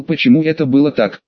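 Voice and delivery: ordinary delivery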